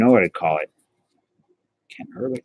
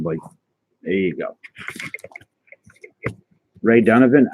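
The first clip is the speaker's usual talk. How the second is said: normal talking voice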